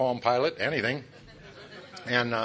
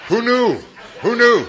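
loud, high "Who knew?"; mock surprise; 10 semitones higher, 11 dB louder than usual. Real, not acted. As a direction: feign amazement to the crowd.

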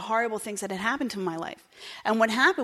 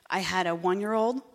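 ordinary talk, nothing marked

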